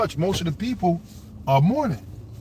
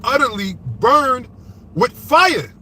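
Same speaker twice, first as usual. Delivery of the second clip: preaching at full force; 5 semitones higher than usual. Acted. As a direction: thunder the judgment over the congregation. Preaching the fire verse.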